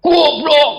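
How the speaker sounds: high-pitched mock angry yell; teriak marah. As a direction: shout the insult at someone standing close, drawn out. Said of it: "Deket tapi teriak". (teriakan marah bernada tinggi)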